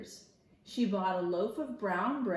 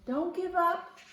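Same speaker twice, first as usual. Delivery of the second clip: much higher, chirpy bird voice; little sparrows; 9 semitones higher, slower than usual. Acted. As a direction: cheer him on like tiny birds. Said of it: "the sparrows came over and said".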